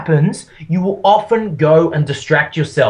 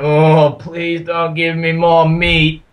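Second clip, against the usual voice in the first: slow, drawn-out, hammed-up American accent; bad American accent; 2 semitones lower, slower than usual. Overfed buffet guest begging off more food, bad American accent. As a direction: do a badly faked American, dragging out the words. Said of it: "That was a really bad American accent".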